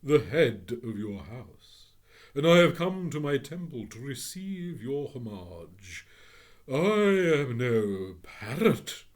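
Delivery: a god demanding homage; scornful phoenix. Acted. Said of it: "Its beak curved scornfully".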